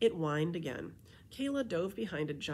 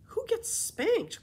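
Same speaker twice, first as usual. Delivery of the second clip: much higher, louder and slow, aghast; shocked cry; 7 dB louder, slower than usual. Acted. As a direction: gasp it out in disbelief. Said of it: "cried Kayla".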